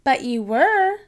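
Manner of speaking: digging in her heels; insisting. Acted. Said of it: "insisted Susie".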